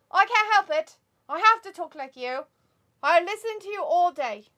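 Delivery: high, loud put-on British accent; mock British accent. Co-host imitating a friend who caught the accent, mock British accent. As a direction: whine loudly in a hammed-up British accent. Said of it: "Travis would totally pick up the accent".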